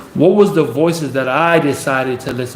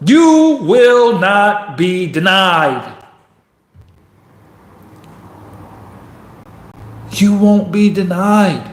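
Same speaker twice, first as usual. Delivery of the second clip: slow, stretched-out rallying declaration; emphatic, drawn-out defiance; defiant motivator; 3 semitones higher, slower than usual. Acted. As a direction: draw out every word like a promise. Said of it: "listen to my voice saying".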